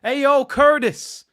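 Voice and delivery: high, loud, slow New York heckle; shouted New York street-style call; bad New York accent. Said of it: "not the greatest of New York accents".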